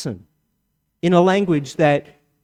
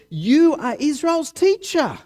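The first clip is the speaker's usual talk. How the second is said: high, slow, pointed rebuke; Jesus to Nicodemus; 12 semitones higher, 5 dB louder than usual. Retold or imitated. Jesus rebuking Nicodemus the teacher, high and slow. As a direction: lift it high and drag it out. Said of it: "He says to Nicodemus".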